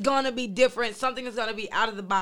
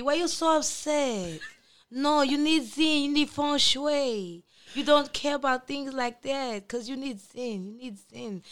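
slow, lightly voiced soothing salon lady; soothing nail tech voice; 4 semitones higher than usual. Lady K imitating Zen as a calming pedicurist, slow and lightly soothing. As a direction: mimic a calm pedicurist soothing a client. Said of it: "She's like the person who doing your feet".

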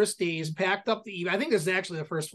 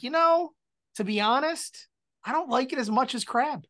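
higher, mock whiny complaint; 7 semitones higher than usual. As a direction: imitate your partner sighing you prefer crab. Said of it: "you were like".

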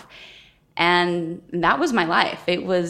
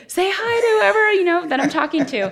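high-pitched quote; 13 semitones higher than usual. Retold or imitated. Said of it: "to say".

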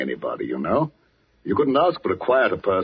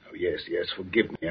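softer, lower, backing down; subdued apology; 6 semitones lower, 8 dB quieter than usual. Acted. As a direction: apologise quietly, voice dropped. Apology after a sharp rebuke.

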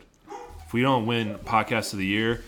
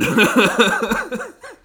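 high laugh; 12 semitones higher, 8 dB louder than usual. Real, not acted. Laughs at own joke.

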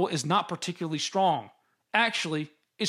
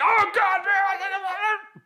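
imitating a screaming fan; 13 semitones higher, much slower than usual. Mocking his cohost screaming into his ear during the game. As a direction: ham up his yelling partner. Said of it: "you just screaming into my ear".

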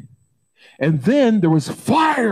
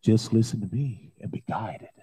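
much softer, hushed voice of God; soft still voice; 8 semitones lower, 6 dB quieter than usual. Acted. God's soft still voice calms the congregation, hushed. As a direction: hush the listener gently, as the still small voice. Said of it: "soft, still voice in our life that says".

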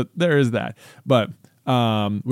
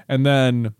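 ordinary delivery

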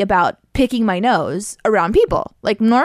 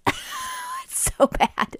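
laughing; 7 dB quieter, much slower than usual. Real, not acted. Reacting to harsh mouth spray.

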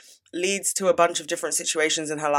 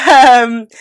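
laughing; 9 semitones higher, 14 dB louder than usual. Real, not acted. Laughs before book update.

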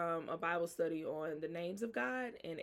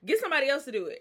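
high, loud, disbelieving; can't believe he's picked; incredulous Gideon; 8 semitones higher, 7 dB louder, faster than usual. Retold or imitated. Gideon told he's mighty, answers in loud high disbelief. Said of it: "Gideon looking like".

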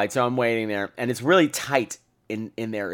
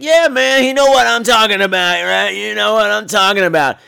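loud bro boasting; 8 semitones higher, 8 dB louder than usual. Retold or imitated. Tin Man bragging loudly.

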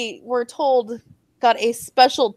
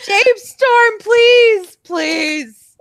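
pleading; 10 semitones higher, 5 dB louder, much slower than usual. Real, not acted. Pleading with the company.

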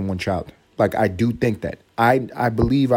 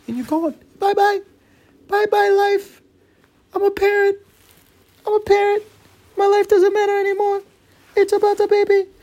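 very high squeaky falsetto, loud and chopped into short bursts; silly parent voice; 5 dB louder than usual. Pretend. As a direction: squeal it like a brainwashed new parent. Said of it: "Nobody talks like that".

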